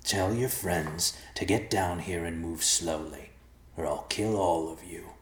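slow, gravelly threat; menacing gravelly stranger. Acted. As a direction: growl orders slowly from the darkness. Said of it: "the gravelly voice said".

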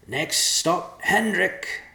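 high, loud coachman's call. A narrator's reading. Morduk calls out as he takes the reins, loud and high. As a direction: announce the stop from the driver's seat. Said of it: "he called out".

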